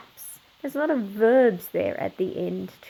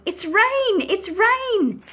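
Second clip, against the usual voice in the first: high-pitched excited story-voice yell; 11 semitones higher, 6 dB louder, slower than usual. A narrator's reading. Mother runs out announcing the rain, excited yell. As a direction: call it out high, as good news.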